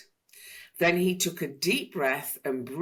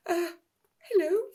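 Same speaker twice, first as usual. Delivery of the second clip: small, much higher squeak; tiny voice. A narrator's reading. Mister Tickle greeting the dragon in a tiny, high voice. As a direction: shrink your voice, nervous and tiny. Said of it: "in a tiny voice".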